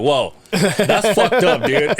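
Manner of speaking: slowed, laughing retort; laughing through it. Retold or imitated. Retelling his reply to the kid, slow and laughing.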